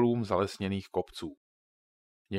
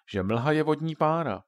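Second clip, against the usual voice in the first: put-on higher girl's voice musing; Katka thinking aloud; 6 semitones higher, 4 dB louder than usual. A narrator's reading. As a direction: puzzle it out as a child. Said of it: "přemýšlela nahlas Katka".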